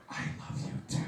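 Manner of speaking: whispered reply of love; soft whispered answer. Retold or imitated. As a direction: whisper it back tenderly.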